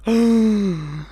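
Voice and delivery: sharp delighted intake of breath; excited gasp. Retold or imitated. Invited onto the float, a thrilled retold gasp. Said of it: "And I was like".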